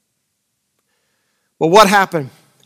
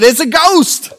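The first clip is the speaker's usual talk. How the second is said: high, startled cry of fear; frightened cry; 9 semitones higher, 4 dB louder, slower than usual. Acted. Disciples spotting a figure on the water, crying out in fright. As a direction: yelp it out like the disciples seeing a ghost. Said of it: "they were troubled and said".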